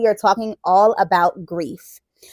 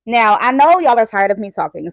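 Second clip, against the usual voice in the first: plain reading voice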